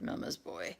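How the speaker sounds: whispered, low scoffing jab; murmured taunt. Acted. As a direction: mutter it under your breath to mock him. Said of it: "a murmured".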